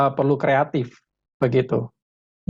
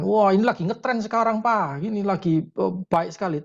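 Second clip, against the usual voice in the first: put-on enthusing teenager; 5 semitones higher than usual. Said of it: "Oh, dia ceritakan".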